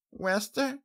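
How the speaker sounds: put-on high little-girl call; small child calling a name; young filly, questioning. A narrator's reading. Child character calls.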